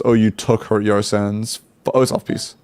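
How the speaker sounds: ordinary talk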